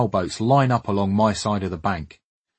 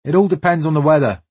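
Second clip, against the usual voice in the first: lightly voiced West African accent, higher; a hint of a West African accent, raised pitch; 6 semitones higher, 6 dB louder, faster than usual. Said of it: "comes a thick West African accent".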